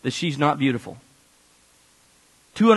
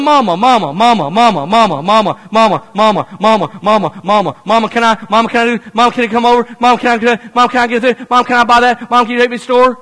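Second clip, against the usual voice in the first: high, loud repeated whine; a kid's endless mama mama mama; nagging child; 4 semitones higher, 6 dB louder than usual. Retold or imitated. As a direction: pester mom without stopping. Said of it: "you know that feeling".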